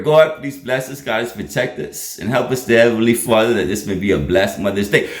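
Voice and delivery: low, unforced spoken prayer; quick prayer. Real, not acted. Pastor asks God to bless Mother's Day, praying. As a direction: drop the pitch into a simple prayer.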